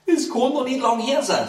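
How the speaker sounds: high-pitched dwarf figure; dwarf's voice. Acted. First dwarf reacting to the death, high character voice. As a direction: jump up high for the dwarf's reply.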